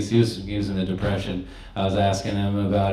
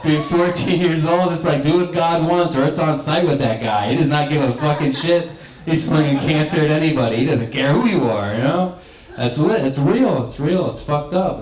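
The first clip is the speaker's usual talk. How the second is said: hyped rant; 6 semitones higher, 3 dB louder than usual. Real, not acted. Hyped rant about God.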